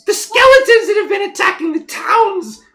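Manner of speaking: very high, loud, alarmed squeal; squeaky halfling. Acted. Halfling tells travellers of skeleton attacks, squeaky shout. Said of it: "this kinda squeaky voice like".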